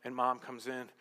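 ordinary talk

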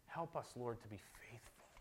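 soft, low, reverent praying voice; prayer. Real, not acted. Pastor leading the congregation in closing prayer, hushed and reverent. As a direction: pray quietly with pauses between phrases.

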